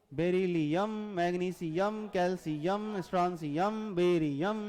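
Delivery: Beryllium through barium in syllables, each final "यम" held; stretched -IUM ending. Shown as a demonstration. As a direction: recite like a primer, leaning on the closing "यम" of every name. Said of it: "मैं जानबूझ के वैसे पढ़ रहा था". (हर नाम का आख़िरी "यम" खींचकर, ज़ोर देकर)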